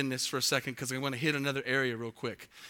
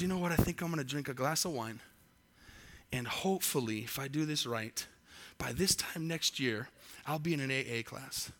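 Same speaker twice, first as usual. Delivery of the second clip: a hint of a casual drinker, softer and quicker; mock drinker's thoughts; 3 semitones lower, 7 dB quieter than usual. Preacher mocking the would-be drinker's plan, softer and light. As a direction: lightly voice the drinker planning his ruin, softer.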